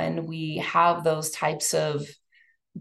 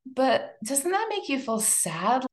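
ordinary delivery

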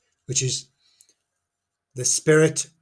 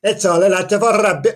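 mock Welsh accent; 5 semitones higher, 7 dB louder than usual. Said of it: "Obviously, he said that in Welsh".

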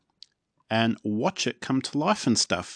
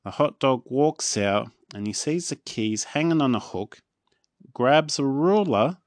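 ordinary delivery, nothing marked

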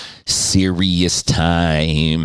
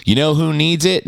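ordinary talk

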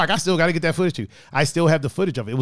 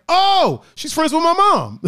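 surprised realization; 12 semitones higher, 5 dB louder, slower than usual. Retold or imitated. Sudden realization in retold story.

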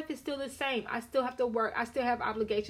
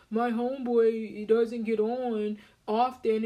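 mock husband excuse; 4 dB louder, slower than usual. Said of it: "husband's excuse quoted".

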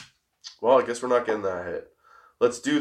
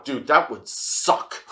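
usual speaking voice

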